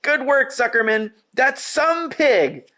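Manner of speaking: high, excited yelling; cheering crowd. A narrator's reading. Fair crowd cheering the pig back on his feet, high yell. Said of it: "yelled the crowd".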